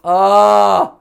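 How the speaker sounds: loud, high drawn-out "ah"; frustrated yell. Real, not acted. Player failing to get the face right, frustrated yell. Game face going wrong, yells.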